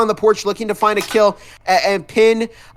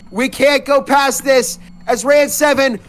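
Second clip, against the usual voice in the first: high shouting play-by-play; esports caster; 8 semitones higher than usual. Real, not acted. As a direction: yell the clutch call. Clutch final moments of semifinal.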